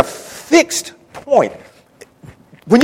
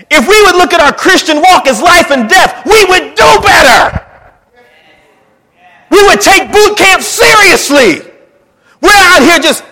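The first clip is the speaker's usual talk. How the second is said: high, loud shouted preaching; preaching at full force; 10 semitones higher, 9 dB louder than usual. Real, not acted. Pastor exhorting the church, full force shouted preaching. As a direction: shout it to the congregation, slow and loud.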